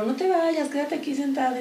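put-on high, coaxing boyfriend's plea; lightly voiced pleading boyfriend. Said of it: "mi novio era como".

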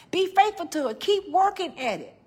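high, loud, fast voice of God urging her on. Acted. God telling the preacher to be a musician, high and fast. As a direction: speak as God, lifted and eager. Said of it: "He said".

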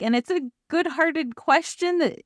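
ordinary delivery

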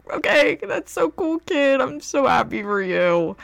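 high, loud, overly bright sarcasm; sugary mock excitement through a grin; fake enthusiasm. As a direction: gush at the kid while hiding jealousy. Faking delight to a kid.

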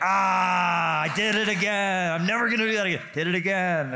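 hammed-up frustration after saying "you too"; self-scolding. After answering the hostess wrongly, a loud mock groan. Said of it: "and you're like".

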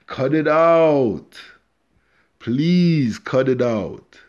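loud, slow, drawn-out begging; exasperated plea. Real, not acted. Begging his audience to stop, loud and slow. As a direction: stretch it out and plead loudly.

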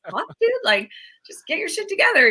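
loud, high mock exasperated guy; mock exasperated man. Man hearing his girlfriend's emotions, mock exasperated shout. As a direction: play the fed-up boyfriend, hammed up. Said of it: "They're just like".

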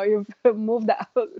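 ordinary delivery

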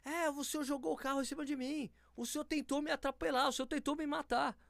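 high and loud accusing shout; loud public accusation. Retold or imitated. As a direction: call him out for the whole street to hear. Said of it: "comecei a falar muito alto".

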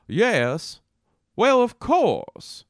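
high, slow, drawn-out Southern gentry voice; Southern aristocratic accent. Shown as a demonstration. Comedian showing kinds of Southern talk, grand Southern aristocrat accent. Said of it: "the Southern aristocratic".

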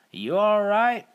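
concerned Texan. Acted. Garrett calls to stranger.

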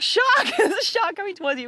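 high yelled warning; calling out Shark to the fisherman; shouted warning. Retold or imitated. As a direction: yell it across the water. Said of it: "we were like".

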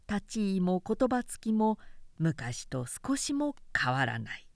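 ordinary delivery, nothing marked

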